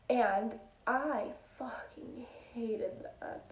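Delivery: slow whispered confession. Real, not acted. Telling viewers about closing shifts, slow whisper. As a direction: whisper it like a guilty secret.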